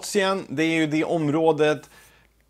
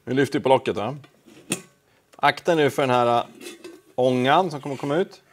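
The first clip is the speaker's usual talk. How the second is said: usual speaking voice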